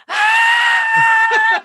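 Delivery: high excited scream with a laugh; retold scream. Wife at the gas station screams with delight. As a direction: shriek with joy at the news. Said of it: "I scream".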